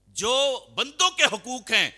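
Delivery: slow, high, loud sermon cry; preaching at full force. Acted. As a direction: drive the point home slowly. Preacher repeats point loudly.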